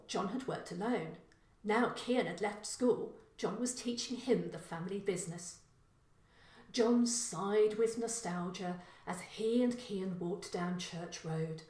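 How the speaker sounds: ordinary delivery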